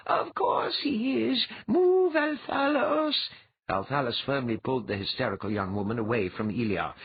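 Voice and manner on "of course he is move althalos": slow, very high, insistent; commanding mind-voice. Acted. Dwea orders Althalos inside his mind, commanding. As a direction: drag out the order, pitched high.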